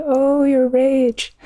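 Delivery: high, slow echo of her rage; mirroring echo. Retold or imitated. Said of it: "And they say".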